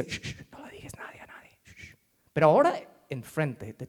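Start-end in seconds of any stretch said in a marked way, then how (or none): 0.0-2.0 whispered shh, fading to nothing; hushing whisper. Acted. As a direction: hush everyone, softer and softer. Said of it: "Antes, él decía".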